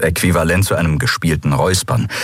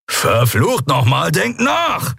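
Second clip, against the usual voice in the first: slow curse, then a high shouted think; snapping at them; 11 semitones higher, slower than usual. A narrator's reading. Kero snaps at the crew to think, angry shout. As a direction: bark it at the whole room. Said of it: "als Kero sie anfuhr".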